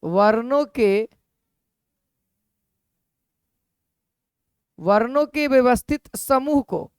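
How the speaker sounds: वर्णों के व्यवस्थित समूह को read slowly for notes; dictating a definition slowly; slow dictation. Shown as a demonstration. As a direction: dictate it word by word. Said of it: "वर्णमाला क्या कहता है".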